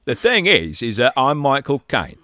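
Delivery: Michael Caine impression. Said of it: "Everyone do their best Michael Caine impression".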